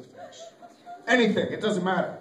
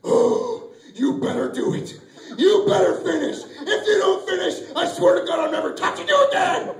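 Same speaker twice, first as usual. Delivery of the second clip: loud, high, threatening rant; hammed-up furious shouting; angry yelling; 9 semitones higher, 4 dB louder than usual. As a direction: yell an exasperated ultimatum at yourself. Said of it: "I'm just yelling at my penis".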